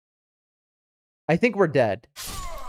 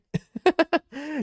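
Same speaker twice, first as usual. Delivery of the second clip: high laugh; 12 semitones higher than usual. Real, not acted. Laughing after disgust remark.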